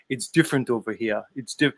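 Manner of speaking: put-on low, soft London accent; London Man accent. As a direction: do London Man's accent, quietly. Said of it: "I'm trying to do the London Man accent".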